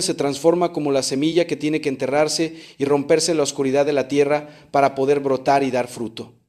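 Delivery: usual speaking voice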